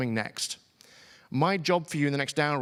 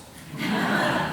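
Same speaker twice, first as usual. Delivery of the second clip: breathy laugh. Real, not acted. Realizes his own mistake.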